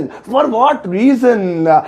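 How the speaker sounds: each word drawn out slowly for the class; very slow dictation. Shown as a demonstration. Teacher dictating the phrase to students, very slowly. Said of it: "English में कहेंगे".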